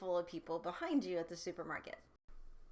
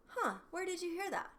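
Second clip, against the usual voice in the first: much higher, light curious huh; curious, relaxed tone; 9 semitones higher than usual. Shown as a demonstration. Parent gently curious about a child's question, relaxed. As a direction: ask it with easy interest. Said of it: "is different than".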